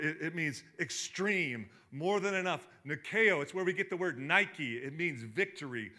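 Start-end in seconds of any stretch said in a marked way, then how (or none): none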